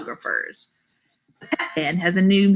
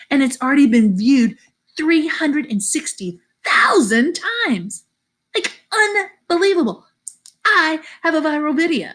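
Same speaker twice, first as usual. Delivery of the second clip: thrilled disbelief; 8 semitones higher than usual. Acted. Kid bragging about a viral video, thrilled and incredulous. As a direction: build to giddy amazement, stretching the big number.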